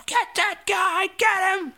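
high, slow mock shout for police; yelling. Staffer yelling to police, high mock shout. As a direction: order them to grab him. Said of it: "he's yelling".

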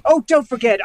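normal talking voice

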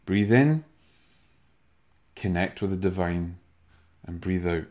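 soft, fading meditation guidance; guided meditation. Shown as a demonstration. Host closing with a breath for listeners, hushed and calm.

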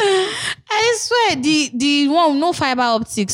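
high squealing laugh through words. Real, not acted. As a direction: laugh so hard the voice jumps up. Laughing fit, pitch high.

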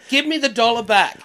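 loud, high-pitched demand for money back; playful indignant shout; mock demand. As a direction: yell it like a wronged punter. Joins in the acted quarrel.

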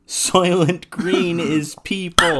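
high, loud, very slow wail; hammy drawn-out revelation; anguished movie cry. Acted. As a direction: cry it out like a horrified film hero. Acting out famous film line.

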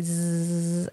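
quiet buzzing exhale like a bee. Shown as a demonstration. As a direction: hum out a soft bee buzz. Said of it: "and then you bumblebee".

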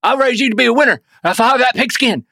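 mock an over-the-top football dad; angry West Texas dad. Imitating a Texan father chewing out his son in a hammed-up accent. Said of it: "gets real upset".

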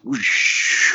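breathy mock whoosh noise; whoosh sound effect. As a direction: voice a quick martial-arts whoosh. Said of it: "like, be like".

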